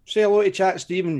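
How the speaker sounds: usual speaking voice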